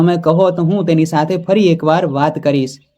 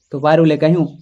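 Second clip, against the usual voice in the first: ordinary delivery, nothing marked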